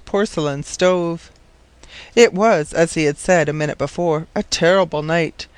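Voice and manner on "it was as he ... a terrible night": ordinary delivery, nothing marked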